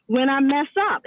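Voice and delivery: normal talking voice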